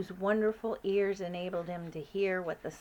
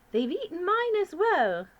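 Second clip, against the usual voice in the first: high, regal, louder queen voice; 11 semitones higher, 8 dB louder than usual. Acted. Queen calling up to the giant, regal and high. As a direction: speak as a commanding queen.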